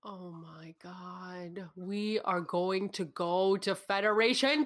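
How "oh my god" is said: slow, much softer, stunned; horrified murmur at the bad news; dismayed. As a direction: let it sink in, slow and hushed. Learns data sent to Federation.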